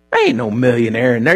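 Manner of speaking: ordinary delivery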